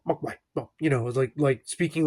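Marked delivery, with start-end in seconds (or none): 0.1-0.7 much softer, put-on exaggerated Chinese accent; hushed mock Chinese accent of the grandfather. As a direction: mimic the old shopkeeper's exaggerated Chinese accent. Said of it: "this really exaggerated accent".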